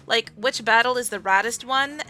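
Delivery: ordinary talk, nothing marked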